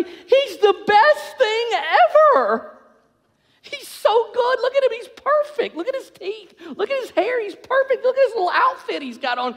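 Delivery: high, gushing starry-eyed voice; giddy infatuation. Retold or imitated. Newlywed gushing over her perfect husband, giddy and high. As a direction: swoon over him like you just fell in love.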